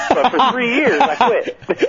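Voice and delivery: laughing through the words. Real, not acted. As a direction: chuckle while admitting it. Laughs at own failed quitting.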